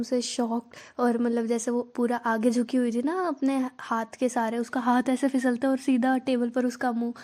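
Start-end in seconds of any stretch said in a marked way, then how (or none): none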